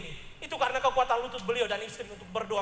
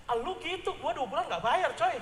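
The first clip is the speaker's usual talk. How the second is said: sarcastic inner voice; 5 semitones higher than usual. Retold or imitated. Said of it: "Dalam hati saya".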